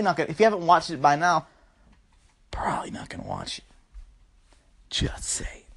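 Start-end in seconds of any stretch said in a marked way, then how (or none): 2.5-5.6 unvoiced, very soft whisper; whispered aside. Real, not acted. Podcaster confides a dig at the listener, whispered aside.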